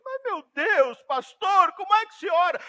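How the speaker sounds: high, loud exasperated plea; crying shout. Acted. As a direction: act a young man crying out in desperation. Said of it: "chorar e gritar e dizer". (grito aflito, encenando um jovem desesperado)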